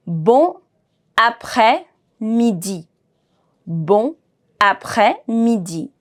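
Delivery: slow Bon, pause, then après-midi; words kept separate. Shown as a demonstration. Teacher assembles the sounds for learners, word by separate word. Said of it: "Let's put all these things together".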